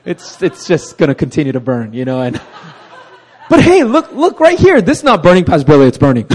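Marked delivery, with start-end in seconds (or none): 3.5-6.3 laughing mock denial. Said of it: "laughing mock denial to Pastor Billy".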